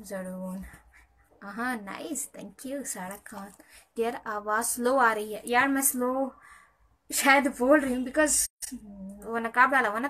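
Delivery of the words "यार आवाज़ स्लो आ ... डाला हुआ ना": raised, louder voice; speaking up. Real, not acted. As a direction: speak up so the stream can hear. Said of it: "मैं तो ज़ोर से ही बोल रही हूँ".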